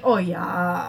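drawn-out Wisconsin "yeah"; thick Wisconsin accent. Retold or imitated. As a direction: imitate a Midwestern mom's thick accent. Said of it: "my mom has a really thick accent".

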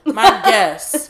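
laughing at her own lisp; giggling through the words. Real, not acted. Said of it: "I really can't say that word".

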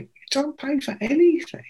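squeaky retold amazement; incredulous echo. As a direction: repeat it back in hushed disbelief. Echoes the answer in amazement.

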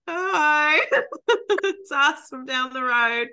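awkward cheerful hi through laughter; sheepish laughing hello. Retold or imitated. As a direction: play it caught out and amused. Greeting the unimpressed shop lady.

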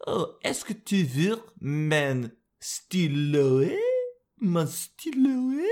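slow, high, hammed-up French offer; mock French accent. Vlogger playing a French speaker offering a pen, hammed-up accent. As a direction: offer the pen like a dainty Parisian. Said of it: "that would be like".

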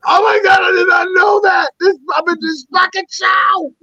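high-pitched squeal of disbelief; re-enacted astonishment. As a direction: shriek it like you just found out you're famous. Retold shock at being in show.

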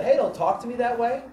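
high, loud defensive comeback; defensive retort. Retold or imitated. Pastor imagining snapping back at a rude lady, loud. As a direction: push back sharply, offended. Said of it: "I could have said".

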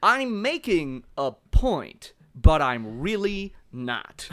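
slow, deliberate smug pundit voice; Tucker Carlson impression. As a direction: stretch each word like a smug TV host. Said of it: "He talks kinda like this".

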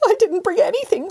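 wailing. Acted. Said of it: "wailed the cat".

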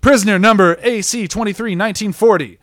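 high, loud guard calling a prisoner; acted yell. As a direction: call it out to get his attention. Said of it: "he yells out".

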